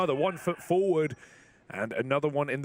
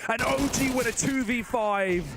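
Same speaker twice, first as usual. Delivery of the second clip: rising hype on the win; excited call; 7 semitones higher than usual. Real, not acted. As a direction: call the clutch win with a lift. Clutch round won.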